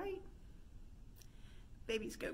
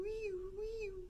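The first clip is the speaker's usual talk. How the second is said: siren noise; 9 semitones higher than usual. Shown as a demonstration. Ambulance noise.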